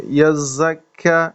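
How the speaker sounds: slow یزکیا with a whistling z and light k; whistled zaa demo. Shown as a demonstration. Teacher modelling یزکیا for the student, hissing z. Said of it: "زا کو تھوڑی اس میں سیٹی کی آواز".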